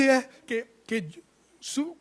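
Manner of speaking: high, gasping imitation of crying; mock sobbing child. Preacher imitating himself crying as a child, exaggerated choking sobs. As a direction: choke the words out like a dramatic crying child. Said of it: "se están ahogando cuando lloran".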